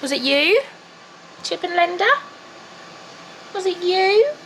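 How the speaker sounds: high, sing-song pet talk to the cats; teasing the cats in a baby voice. Acted.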